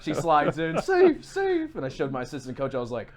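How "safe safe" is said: high, loud re-enacted call; excited safe call. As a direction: shout it out like the play at the plate. Acts out call at home plate.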